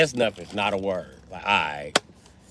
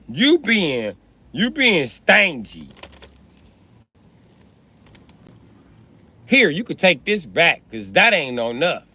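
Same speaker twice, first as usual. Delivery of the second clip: put-on toddler scolding daddy; 4 semitones higher, slower than usual.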